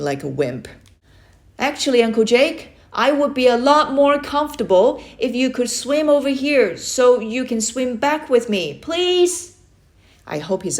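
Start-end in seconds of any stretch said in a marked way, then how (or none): 1.6-9.3 high, louder pleading call; nervous plea. Acted. Frightened boy pleads with his uncle to swim over, nervous plea. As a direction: beg him politely but scared.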